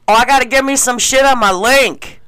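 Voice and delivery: hammed-up copy of his demand; imitating the wannabe guy. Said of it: "And he's like".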